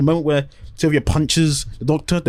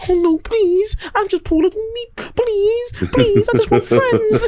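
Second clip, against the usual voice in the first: tiny high-pitched creature squeal; pleading Meep; slower than usual. Retold or imitated. Small alien begs screaming Donna, squeaky pleading. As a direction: plead in a squeaky, hammed-up little voice. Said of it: "And the Meep's like".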